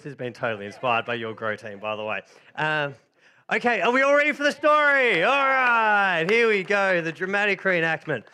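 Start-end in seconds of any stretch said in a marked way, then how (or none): 3.5-7.0 bright, louder call to start; excited kickoff. Real, not acted. Leader hyping the children before the play, excited. Kicking off the story for kids.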